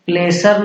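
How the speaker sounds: "प्लेसर" with an s and sounded r; wrong pronunciation. Shown as a demonstration. Teacher warning learners off a misreading, wrong pronunciation "प्लेसर". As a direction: misread pleasure as "प्लेसर", PLAY-ser. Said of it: "प्लेसर नहीं पढ़ना है".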